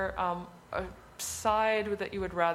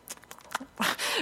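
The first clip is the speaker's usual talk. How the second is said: breathy laugh; 6 semitones higher than usual. Real, not acted. Laughing after the whisper demo.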